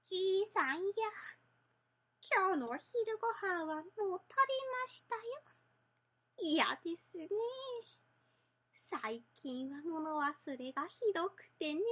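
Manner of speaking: a gentle forgetful grandma talking to her husband; old woman voice. Shown as a demonstration.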